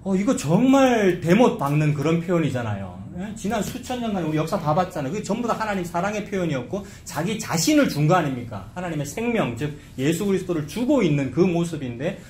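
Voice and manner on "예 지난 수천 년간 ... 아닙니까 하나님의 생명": ordinary talk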